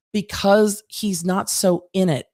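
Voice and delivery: ordinary delivery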